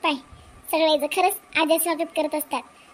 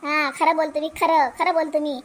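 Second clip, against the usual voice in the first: ordinary talk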